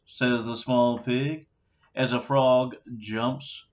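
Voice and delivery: ordinary delivery, nothing marked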